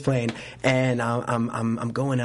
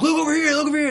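high, raised photographer's call; mimicked calling out; 5 dB louder, faster than usual. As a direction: imitate photographers vying for attention.

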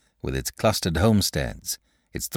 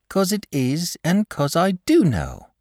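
put-on high boy's voice, quietly sure; quiet reply; 11 semitones higher than usual. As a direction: answer as a mildly insistent boy. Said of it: "replied the boy quietly".

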